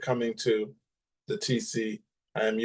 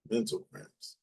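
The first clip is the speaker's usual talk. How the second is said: "Mental cramps" murmured much softer, puzzled; puzzled repeat; 10 dB quieter than usual. Shown as a demonstration. Rereading a comment, she softly repeats "Mental cramps" in puzzlement. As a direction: quietly question the odd phrase "Mental cramps". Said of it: "yeah, it says mental cramps".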